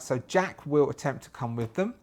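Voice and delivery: ordinary delivery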